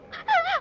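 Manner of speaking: high laugh. Acted. Girl laughs at paper dolls.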